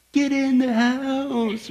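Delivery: high, very drawn-out call; slow sing-out, held-back holler; mock distant call. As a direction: call a kid indoors without real volume. Parent calling child indoors, retold.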